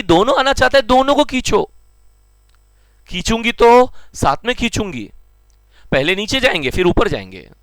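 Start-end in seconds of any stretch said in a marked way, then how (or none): 0.0-5.1 higher, lightly voiced loving mother; a caring mom calling both kids close; mother gravity speaking. Teacher voicing gravity as a mother pulling both children, lightly higher. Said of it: "माँ बोलेगी".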